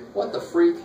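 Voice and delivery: high whispered mock panic as the calculator; calculator freaking out. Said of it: "it's gonna go".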